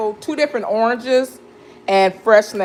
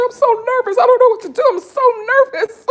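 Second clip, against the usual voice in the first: high squeaky imitation of her own nerves, laughing at the end; mock nervous; 4 dB louder than usual. Retelling to viewers what she told her husband, mock nervous squeak. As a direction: play up the jitters in a tiny high voice. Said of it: "I said".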